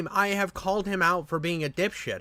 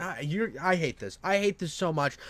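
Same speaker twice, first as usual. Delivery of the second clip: ordinary talk, nothing marked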